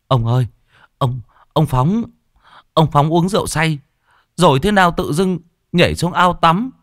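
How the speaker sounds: put-on a frightened boy gasping out the news; boy blurting that the old man drowned; distressed report.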